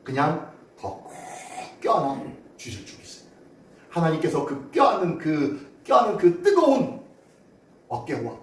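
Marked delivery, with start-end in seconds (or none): 0.0-3.4 much softer and lower, slowing to a whisper; whispered reassurance; hushed. Real, not acted. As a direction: let it fall to a tender hush. Tender hush about God's embrace.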